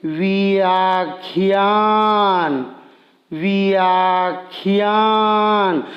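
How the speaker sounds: each sound stretched very slowly; slow motion. Shown as a demonstration. Teacher slowing a word so pupils hear the parts. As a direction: drag the word out syllable by syllable. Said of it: "हम एकदम slow motion में बोलेंगे".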